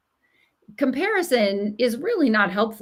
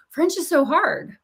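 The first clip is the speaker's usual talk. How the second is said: lightly imitated higher-pitched whine; mock teenage complaint; 6 semitones higher than usual. Voices a teenage daughter's complaint.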